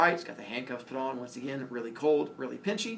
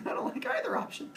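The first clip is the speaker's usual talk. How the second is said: tiny high squeak. Retold or imitated. Retold reply, trapped by cops.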